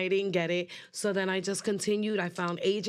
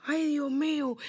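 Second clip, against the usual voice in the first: stunned gasp; 7 semitones higher than usual. Retold or imitated.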